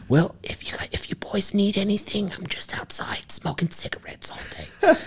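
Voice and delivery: high, unvoiced whisper of an old aunt; imitating Aunt Felicia in a high, breathy whisper; whispery aunt voice. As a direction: rasp it out like an aunt between cigarettes. Impersonating chain-smoking aunt.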